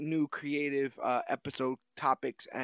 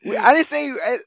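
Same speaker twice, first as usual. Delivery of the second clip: higher, louder, giddy and carried away; getting crazy; 8 semitones higher, 10 dB louder than usual. Real, not acted. Host cracking himself up over a future-spoiler joke, manic. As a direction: ramp it up like a man losing it. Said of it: "I'm getting crazy".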